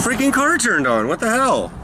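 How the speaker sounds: high startled outburst; surprised. Real, not acted. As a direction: caught off guard.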